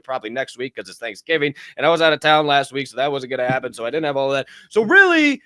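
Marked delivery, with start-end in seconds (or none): 4.7-5.4 high, loud exclamation; sudden high, loud burst; emphatic burst. Real, not acted. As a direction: hit it like a revelation.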